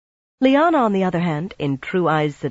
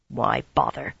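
growled; 5 semitones lower, 3 dB quieter than usual. A narrator's reading. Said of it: "she growled".

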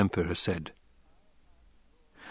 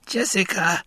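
pleading call; 10 semitones higher than usual. A narrator's reading. Old woman calling out.

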